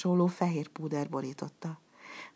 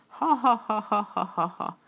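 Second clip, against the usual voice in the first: menacing laugh; 5 semitones higher than usual. Acted. Puppet master laughing threateningly at Burattino. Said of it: "rivalt Burattinóra".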